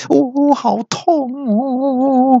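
very high, slow, hammed-up yelp of pain; a high, drawn-out mock wail of pain; cry of pain. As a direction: whine out the pain in a cartoonish way. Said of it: "四弟痛得将手缩回来".

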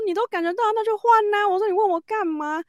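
retold high-pitched exasperation; exasperated retort. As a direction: toss it back at him, baffled.

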